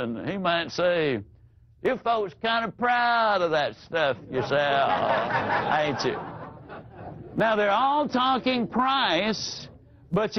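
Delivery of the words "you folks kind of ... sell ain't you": put-on good old boy drawl. Folksy prospect teasing the salesman, Southern drawl. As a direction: play a ribbing down-home Southern buyer. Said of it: "good old boy down home type fella".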